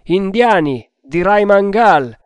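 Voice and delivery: loud, high hail in reply; shouted answer. A narrator's reading. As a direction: call your identity across the water. Shouted answer across the water. (risposta gridata a gran voce)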